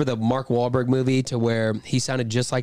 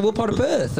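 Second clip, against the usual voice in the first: high-pitched mimicked Aussie question; put-on Australian accent; 8 semitones higher than usual. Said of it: "another Australian guy".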